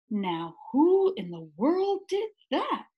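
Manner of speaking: a hint of a gruff night watchman exclaiming; lightly voiced watchman. A narrator's reading. Watchman hearing the crash, mildly exclaiming. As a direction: call out puzzled into the dark store. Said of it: "he exclaimed".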